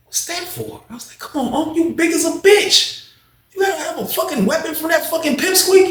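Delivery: high, squeaky incredulous teasing; mocking disbelief. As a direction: rib the big man for needing a knife. Teller mocks Unc's knife.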